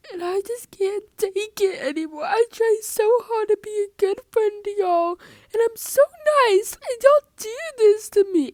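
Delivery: high, loud, whiny mock voice of a dramatic friend. Mocking a dramatic friend's complaint to the teacher, high whiny wail. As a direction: ham up her tearful complaint, high and loud.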